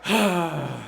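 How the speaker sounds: long audible exhale; sigh. Real, not acted. Getting back to the recap after a tangent, long sigh.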